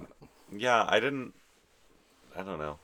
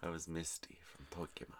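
whispering; 2 semitones lower, 15 dB quieter than usual. Real, not acted. Aside said under breath.

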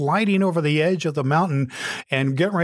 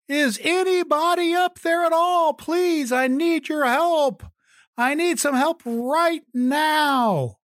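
calling out again. Acted. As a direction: beg upward, voice straining for help. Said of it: "He called out again".

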